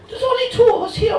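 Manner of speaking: fast, loud Cork shout; exasperated fast Cork yell; Cork accent, shouted. Pretend. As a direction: bark it out fast in Cork brogue. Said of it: "delivered in a dodgy Cork accent".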